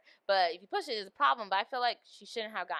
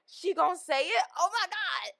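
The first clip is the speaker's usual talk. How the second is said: high, louder gasping disbelief; excited shock; 10 semitones higher, 6 dB louder than usual. Retold or imitated. Retelling watching the scene, high excited disbelief. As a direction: squeal it out in shock. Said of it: "So I was like".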